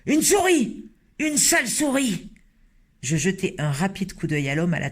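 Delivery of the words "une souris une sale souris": a cook shrieking at a mouse; alarmed shout. Acted. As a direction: yell it at the mouse in horror. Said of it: "J'entendis un homme s'écrier".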